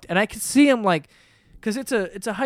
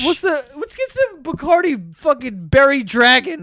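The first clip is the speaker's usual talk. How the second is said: party bro voice; 8 semitones higher, 3 dB louder than usual. Pretend. Bro bit continues.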